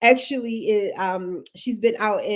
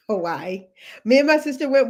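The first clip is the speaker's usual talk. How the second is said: ordinary delivery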